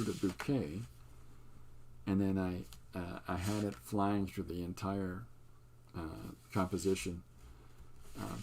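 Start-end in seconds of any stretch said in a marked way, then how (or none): none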